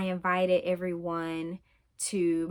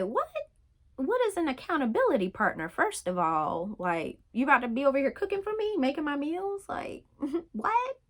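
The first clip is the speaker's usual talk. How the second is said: much higher, fast, baffled questioning; incredulous disbelief; 7 semitones higher than usual. Retold or imitated. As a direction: rattle off the questions in playful disbelief. Baffled reaction to friend's text.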